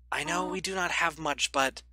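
voicing Toriel. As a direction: Toriel gently begging the child to go upstairs. Reads Toriel's lines aloud.

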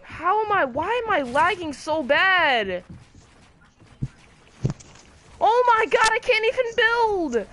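raging gamer. Real, not acted. Player lagging badly, frustrated.

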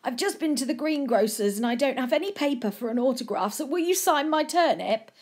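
a hint of an eager old gent.

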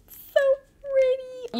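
very high, slow, near-tearful squeal; squealing delight. Real, not acted. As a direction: melt over how pretty it is. Reacting to the ring.

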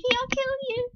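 high, loud squealing threat; fangirl squealing. Acted. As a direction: shriek the threat like a crazed fan. Obsessed fangirl threatens listener.

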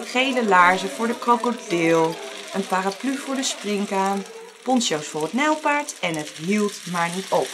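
usual speaking voice

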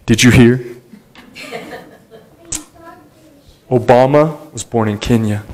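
low, hushed rumour voice; a lightly voiced gossip; gossiping conspiracy believer. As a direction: mimic someone passing on a rumour, low and quiet. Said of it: "Let me use something old".

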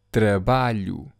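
ordinary talk, nothing marked

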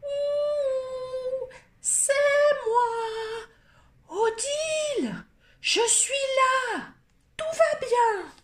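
put-on very high, tiny child's voice; a small voice. Odile reassuring everyone from inside the crocodile, tiny high voice. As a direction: call out small and bright from inside the beast. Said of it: "une petite voix s'éleva".

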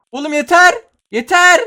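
loud high cry of enough; exasperated shout. Real, not acted. Gamer fed up with the stuns, loud shout. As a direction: shout at the screen to stop.

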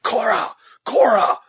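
high, loud cry of her name; urgent call ringing out high; echoing cry. A narrator's reading. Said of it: "echoed Uncas".

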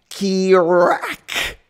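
loud, high imitated thunder crack. Storm breaks over the town, loud thunder imitation. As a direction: make the storm crack overhead.

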